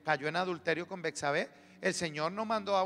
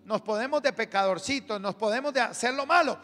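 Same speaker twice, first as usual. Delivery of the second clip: loud, raised-pitch preaching; forceful warning; 4 semitones higher, 6 dB louder than usual. Real, not acted. Preacher warning the congregation about rebelling and sinning, forceful warning. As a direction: press the warning home, voice up.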